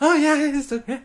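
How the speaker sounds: high, bright-eyed mock cheer; chipper colleague. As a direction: imitate an over-happy new teacher, pitched high. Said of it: "annoying with how happy he is".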